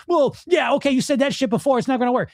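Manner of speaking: high-pitched, dismissive mocking; sarcastic inner voice. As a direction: scoff like a sarcastic inner critic. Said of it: "what's it gonna say?".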